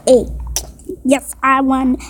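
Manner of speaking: usual speaking voice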